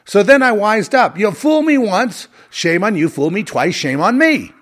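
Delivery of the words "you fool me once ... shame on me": ordinary delivery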